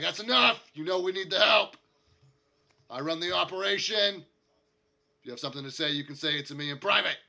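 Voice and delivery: loud, high shout to shut down dissent; angry boss shouting. Acted. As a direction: assert your authority at full volume. Boss shuts down worker's complaint.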